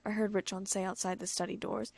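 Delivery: ordinary delivery, nothing marked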